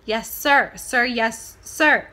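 loud barked "Yes, sir"; hammed-up drill reply; mock military. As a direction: snap to attention like a soldier.

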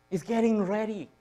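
ordinary talk

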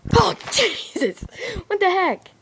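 high loud shocked cry with laugh; startled yell. Real, not acted. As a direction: jump and shout, half laughing. Jolted by a sound after call.

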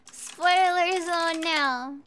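slow, stretched-out declaration; drawn-out announcement. Real, not acted. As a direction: stretch each word like an announcement. Announcing spoiler zone.

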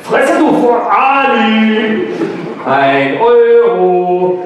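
put-on slow, drawn-out teen retort; annoyed teen. Ali snapping back at the girl, slow and drawn out. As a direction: drag out the words to show off.

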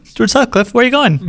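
high-pitched mock calling. Co-host calls after another runaway Beatle, mock calling out. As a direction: call after someone wandering off, playfully.

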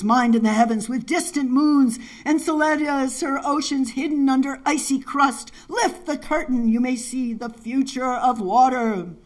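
high, intoned recitation; ringing verse, stretched at the close; incantatory poem reading. Acted. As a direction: lift the pitch and chant the lines.

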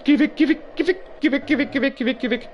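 loud, frantic repeated go away; frantic get away; panicked shooing. Real, not acted. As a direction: shoo the monster in fright.

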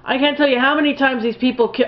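normal talking voice

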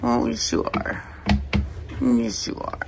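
soft breathy coo. Real, not acted. Owner whispering praise to her puppy, softly. Whispered baby talk to dog.

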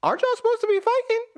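high, fast squeaky disbelief; confused soldier squeaking up at the general; baffled disbelief. Retold or imitated. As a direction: squeal it like you can't believe the order. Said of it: "I was like".

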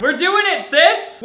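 high, loud and eager; hyped-up cheer. Acted. Reading his story character's line, excited cheer. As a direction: cheer it like a bestie pumping you up.

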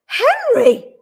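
high, loud shocked cry; scandalised wife's outcry. A narrator's reading. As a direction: shout the name in outrage. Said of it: "cried Missus Brown".

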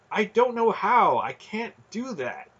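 high, distressed objection, dropping at the end; desperate protest. A narrator's reading. Boy telling the inner voice he can't, desperate. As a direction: protest helplessly, pitched high.

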